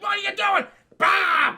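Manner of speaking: high, loud hammed-up yell; mock angry shout. Hero yelling at Nova as he backhands her, hammed-up loud shout. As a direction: bark it out like a furious caveman hero.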